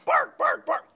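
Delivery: high playful pretend barks; dog barking. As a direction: yap like an excited puppy. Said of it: "I'm pretending to be a dog".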